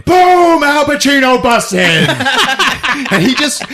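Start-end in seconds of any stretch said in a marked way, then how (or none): 0.0-2.1 high, loud burst of narration; excited hollered climax; explosive storytelling. Real, not acted. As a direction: shout the boom and ride it through. Climax of the telling.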